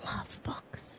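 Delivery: breathy secret whisper. Pretend. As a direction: whisper it like a confession. Whispering a confession about books.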